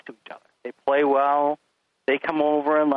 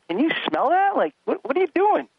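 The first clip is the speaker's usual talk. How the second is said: higher, mock incredulous dad; exasperated dad; 13 semitones higher than usual. Father to son barging in, mock exasperated. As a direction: play up the disbelief, half-joking. Dad retelling his own complaint.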